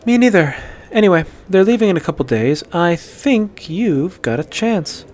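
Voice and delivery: cheeky Ayala. A narrator's reading. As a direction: egg him on playfully. Ayala teasing.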